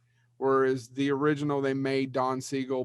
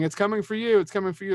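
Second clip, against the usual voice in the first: higher-pitched mock scream, not full volume; imitated scream; 7 semitones higher, 3 dB louder, faster than usual. Podcast host re-enacting the film's ending, mock screaming. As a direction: imitate a panicked film warning without really screaming. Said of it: "him screaming".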